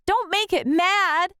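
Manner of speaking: high, urgent warning; anxious kid warning dad. A narrator's reading. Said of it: "I warned".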